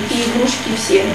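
ordinary talk

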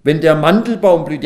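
high, forceful announcement of a verse; scripture reading. Acted. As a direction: proclaim it across the room. Reads out the next verse.

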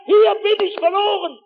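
high, loud cry for rescue; desperate shouting. Retold or imitated. Lost walker crying out to searchers, shouting. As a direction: shout so searchers can find you. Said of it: "Ich konnte noch schreien".

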